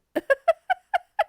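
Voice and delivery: high, loud burst of laughter. Real, not acted. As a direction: crack up at yourself. Laughing at own story.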